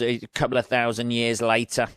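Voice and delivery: ordinary talk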